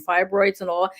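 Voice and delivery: usual speaking voice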